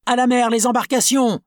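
high, loud call to the boats; panicked shout. Acted. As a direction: scream for the lifeboats. Crew panicking.

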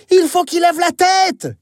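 high urgent shout. Acted. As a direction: call out urgently. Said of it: "crie Billy".

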